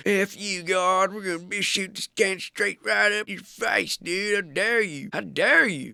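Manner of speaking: high-pitched, hammed-up indignation; mock outrage. Humans cursing God over a wrecked world, mock outrage. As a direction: play at railing against the heavens. Acted angry rant at God.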